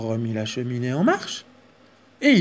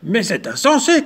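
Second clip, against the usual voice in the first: loud, higher outraged cry; shouting bear; 6 semitones higher, 7 dB louder than usual. Acted. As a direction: cry out in disbelief. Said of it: "cria l'ours".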